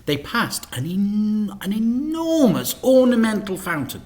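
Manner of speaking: ordinary delivery, nothing marked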